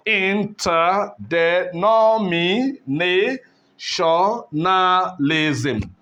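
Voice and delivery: "interdenominationalism" slowly, every syllable on its own beat; teacher taking time to split "interdenominationalism". Shown as a demonstration. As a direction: separate each syllable of "interdenominationalism" evenly. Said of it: "if you take your time to split this".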